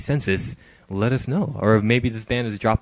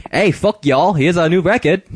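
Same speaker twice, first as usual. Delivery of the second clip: loud, brash mock Australian accent; cocky rock star in a mock Australian accent; 7 semitones higher, 5 dB louder than usual. Host voicing U2 taunting fans in a mock Australian accent. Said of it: "I know he's Irish".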